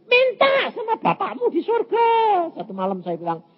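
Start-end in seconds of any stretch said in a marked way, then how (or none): 0.1-2.1 high-pitched mock yell; retold shout. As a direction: call it out high, retelling an exchange. Retelling his shouted reply to spouse. (seruan lantang bernada tinggi, menirukan)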